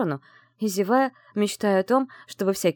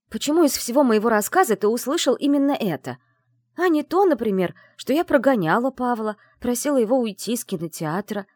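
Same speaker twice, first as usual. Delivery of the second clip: pleading; 5 semitones higher, 4 dB louder than usual. Acted. Pleading with jealous fiancé.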